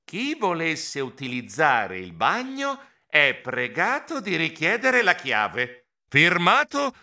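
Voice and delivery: slow, deliberate read-out; reading a sign. Acted. Mouse reading the tiny bathroom notice, slow read-out. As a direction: spell out the notice word by word. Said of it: "E lessi".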